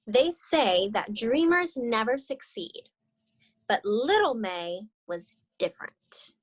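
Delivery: gentle read-aloud storytelling; story narration. Acted. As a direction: read it like a children's picture book. Reads the picture book aloud.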